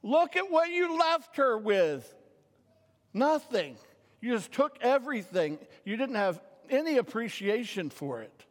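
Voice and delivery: high, loud indignant scolding; angry outburst. Retold or imitated. Preacher reliving his childhood anger at the boy in the book, furious scolding. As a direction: tear into the boy as if he's right there. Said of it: "I was so angry at this guy".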